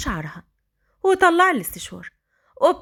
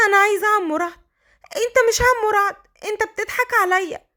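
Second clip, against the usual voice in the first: put-on higher, protesting child; insisting girl; 8 semitones higher than usual. A narrator's reading. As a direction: she refuses the impostor.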